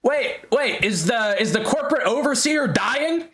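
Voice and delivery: high-pitched, flustered call; mock shout in confusion, pitched well up; confused shouting doctor. Confused doctor trailing behind the runner, high mock shout. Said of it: "multiple confused doctors shouting".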